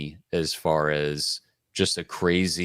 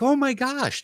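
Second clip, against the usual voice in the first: much higher-pitched mock amazement; retold astonishment. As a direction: re-enact a jolt of happy disbelief. Acted astonishment at recognising coworker.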